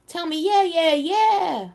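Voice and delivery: high, loud rising chant; playful insistence. Real, not acted. Host urges a viewer to say yes, playful loud pleading. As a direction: belt it out high, begging for a yes.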